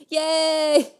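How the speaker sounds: high little cheer; mock cheer. Real, not acted. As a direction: cheer it ironically.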